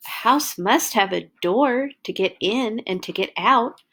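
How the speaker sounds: ordinary delivery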